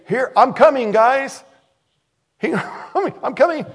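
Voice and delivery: high, loud hail to friends; mock calling out. Preacher imagining Jesus announcing himself to the boat, mock calling out.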